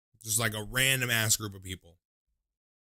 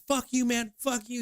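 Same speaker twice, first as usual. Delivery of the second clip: angry taunt; 13 semitones higher than usual. Retold or imitated. Instigator yelling in wrestler's face.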